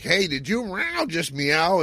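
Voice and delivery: high, startled question, drawn out; mock surprise. Spock startled at Prentice meowing, high surprised question. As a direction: act amazed at the meow. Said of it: "Spock's like".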